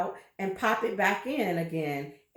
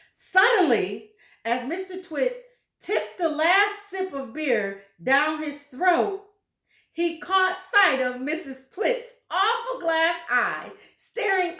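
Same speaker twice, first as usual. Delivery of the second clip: building excitement; 5 semitones higher, 4 dB louder than usual. A narrator's reading. Reader builds to the fright.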